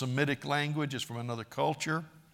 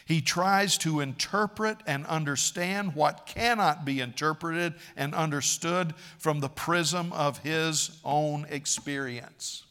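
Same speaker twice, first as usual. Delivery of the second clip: usual speaking voice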